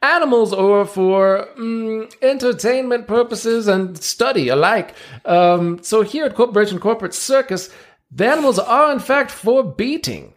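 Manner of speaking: showman ringmaster. Acted. Ringmaster presenting seals to the crowd, grand showman voice. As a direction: play the circus ringmaster working the crowd.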